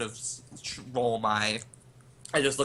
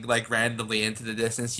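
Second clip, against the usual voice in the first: ordinary talk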